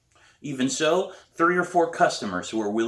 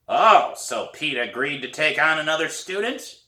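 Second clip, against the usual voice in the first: put-on hoarse old woman; 6 semitones higher, 4 dB louder than usual. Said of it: "It's a hoarse voice".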